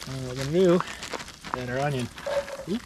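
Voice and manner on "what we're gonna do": ordinary delivery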